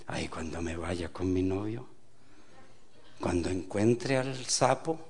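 soft, low, mock wistful daydream; dreamy single woman. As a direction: sigh over a future romance. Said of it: "Cuando están solteras dicen".

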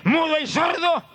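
orden tajante, muy aguda y lenta; reprensión al demonio. Acted. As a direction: reprende como quien expulsa un espíritu. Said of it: "dice que reprendió al demonio". (reprendiendo con voz alta y autoritaria)

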